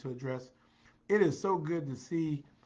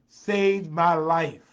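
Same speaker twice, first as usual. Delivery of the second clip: slow, much louder proclamation; loud emphatic declaration; 3 semitones higher, 12 dB louder, much slower than usual. Real, not acted. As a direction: belt out each word for emphasis. Builds repeated line to emphatic climax.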